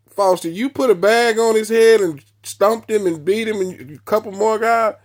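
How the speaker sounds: mock the charge with disbelief; a lieutenant half laughing at the accusation; amused lieutenant. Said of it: "they laughing".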